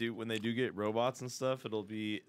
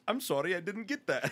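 higher-pitched mock machine apology; automated phone agent; 6 semitones higher than usual. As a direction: mimic a phone menu apologising. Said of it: "and it goes".

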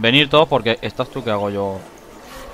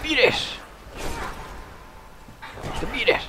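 high yell; 13 semitones higher, 9 dB quieter, slower than usual. Real, not acted. Shouting at enemies.

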